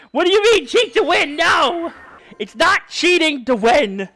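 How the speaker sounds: high, loud outraged protest; outraged yell. Real, not acted. Streamer yelling at chat calling it cheating, outraged. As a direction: shout down the accusation.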